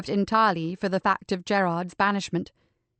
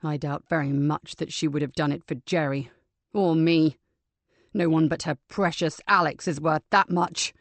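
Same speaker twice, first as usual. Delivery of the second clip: cold; 4 semitones lower than usual. Acted. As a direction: lower and bitter, a cold resentment. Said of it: "Richard looked at his mother coldly".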